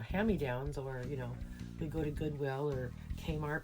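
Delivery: usual speaking voice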